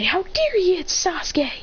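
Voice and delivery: put-on high, slow outraged girly voice; mock fangirl outrage, pitched high; indignant fangirls. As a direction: squeal it like offended fans. Fangirls shouting in story.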